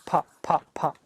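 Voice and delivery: "Pop, pop, pop" as short puffed pops; light popped "Pop, pop, pop" bursts; popping sounds. Shown as a demonstration.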